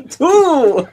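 high, loud vampire counting shout. Pretend. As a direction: shout the number like Count von Count. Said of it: "historical home of the Count".

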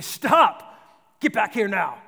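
sharp, loud cry of alarm; urgent warning shout. Pretend. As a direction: shout a child back from danger. Parent warning child off street.

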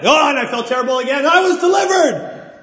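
high, loud, rushed mock testimony; testimony parody. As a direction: wail it like an overwrought testimony giver. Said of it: "everybody's testimony is".

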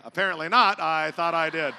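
loud, high comic exasperation; hammed-up loud self-doubt; indignant retort. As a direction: blurt it out as if mock-offended. Pastor's indignant retort in a joke.

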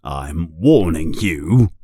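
a low menacing uncle; threatening uncle. A narrator's reading. Uncle warning Harry before the zoo, low.

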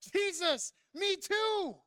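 loud, high cry of "Jesus, me too"; shouting out. Pretend. Pastor voicing the crowd's cry, loud pleading shout. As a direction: call it out like the whole room. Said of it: "should have been shouting out".

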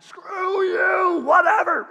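loud, high, rude shout back at the king; hammed-up shout of defiance; mock defiant yell. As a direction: yell it like a cheeky rebel. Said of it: "Did they yell back".

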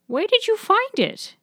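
high grandmother asking. Acted. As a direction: pitch way up for Nana. Nana voiced by narrator.

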